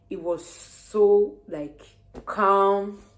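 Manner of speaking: slow, loud stretched so and calm; drawn out. Real, not acted. Describing the restaurant's mood, slow and drawn out. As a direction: stretch the words out to savour them.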